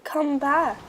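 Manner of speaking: calling out after the dogs; shouted call across the ice; narrated shout. A narrator's reading. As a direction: raise the voice to call them back. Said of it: "Aluki shouted".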